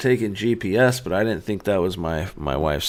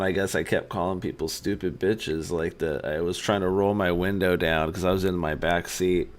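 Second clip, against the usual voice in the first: normal talking voice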